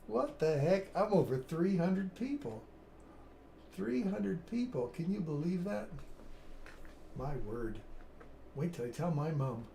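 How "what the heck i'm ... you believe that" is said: amazed. Real, not acted. Reacting to three hundred viewers.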